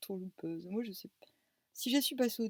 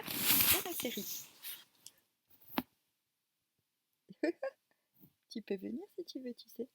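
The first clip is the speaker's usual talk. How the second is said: sing-song pet talk, pitched up; cooing baby talk; 3 semitones higher than usual. Acted. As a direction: coax the kitty sweetly. Calling the cat sweetly.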